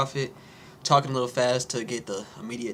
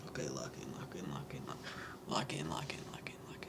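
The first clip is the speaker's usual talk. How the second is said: hushed, whispered psyching up; whispered self pep talk; 10 semitones lower, 11 dB quieter than usual. Real, not acted. Speaker tells himself to lock in before recording, whispered pep talk. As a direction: whisper it to yourself to focus.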